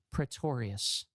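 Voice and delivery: tired low correction with a sigh; weary sigh. Real, not acted. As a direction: sigh the name out slowly.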